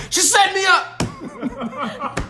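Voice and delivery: laughing. Real, not acted. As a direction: laugh out the complaint. Laughing mock complaint.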